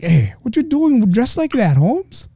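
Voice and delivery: high, lightly put-on Mexican accent; mock Mexican challenger. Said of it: "the Mexicans like".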